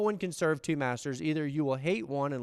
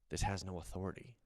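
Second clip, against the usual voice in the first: quiet realization; 7 semitones lower, 8 dB quieter than usual. Retold or imitated. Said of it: "he goes".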